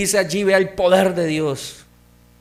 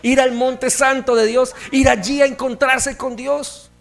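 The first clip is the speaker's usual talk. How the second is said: loud, higher preaching climb; fervent preaching; 7 semitones higher, 6 dB louder than usual. Acted. Pastor urges the church upward like the eagle, loud and high. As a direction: lift them up to the mountain.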